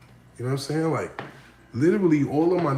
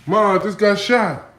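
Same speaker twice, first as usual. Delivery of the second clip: put-on slow, higher, louder pleading kid; mock kid to mom; 8 semitones higher, 9 dB louder than usual. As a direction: play it hammed-up and urgent. Kid telling mom he's shot.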